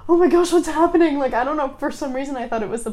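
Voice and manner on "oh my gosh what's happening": gasp, then high, loud retold alarm; startled panic. Student retelling being woken by knocking, startled and panicky. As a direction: gasp and blurt it in mock panic.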